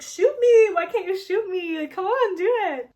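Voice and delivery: villain jeering loudly at the hero; taunting. Retold or imitated. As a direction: goad him to pull the trigger. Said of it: "taunts him".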